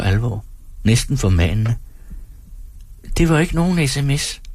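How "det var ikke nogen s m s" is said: lightly voiced high, childish woman; a hint of a small, thin voice. A narrator's reading. Woman denies texting to the performer, lightly childish thin voice. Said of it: "Hendes stemme er barnlig og tynd".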